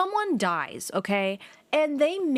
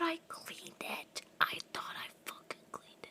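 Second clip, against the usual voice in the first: dismayed whisper; 7 semitones higher, 16 dB quieter than usual. Real, not acted. Surprised by game outcome.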